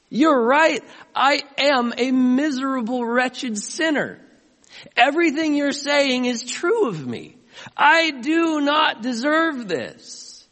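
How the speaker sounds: high, loud, slow admission of guilt; a sinner admitting guilt to the accuser; anguished confession. Acted.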